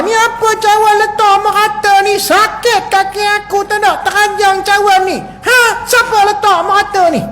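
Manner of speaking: hammed-up husband's angry complaint; mock angry husband. Husband kicks a cup and scolds, mock angry. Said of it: "Suami ni marah".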